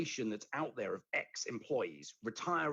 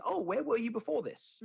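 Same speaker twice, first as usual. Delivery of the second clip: light mock interviewer, pitched higher; new employer asking; 10 semitones higher than usual. Podcast guest voices a new employer quizzing a hire, higher mock voice. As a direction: play the curious new boss asking, pitch up. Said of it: "the first thing someone's gonna say is".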